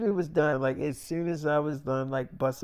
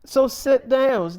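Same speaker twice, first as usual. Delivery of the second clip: stern mom; 9 semitones higher, 9 dB louder than usual. Retold or imitated. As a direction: shut the kids down. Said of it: "she's like".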